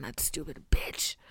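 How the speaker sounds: whispered jab; whispered aside about the therapist. Real, not acted. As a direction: hiss it under your breath. Aside insulting absent therapist.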